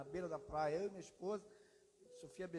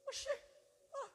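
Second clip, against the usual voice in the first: surprised oxê. Real, not acted. Espanto com o cronômetro.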